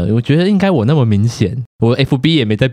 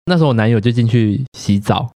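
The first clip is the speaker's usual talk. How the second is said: ordinary talk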